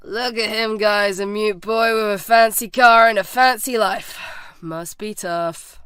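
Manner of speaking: loud jeer, slowing to a mock-sympathetic finish; put-on bully sneer; sarcastic. A narrator's reading. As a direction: show him up in front of the gang.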